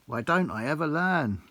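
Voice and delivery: ordinary delivery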